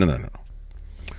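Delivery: whispered no. Real, not acted. Rejecting a reading.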